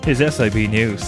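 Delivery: normal talking voice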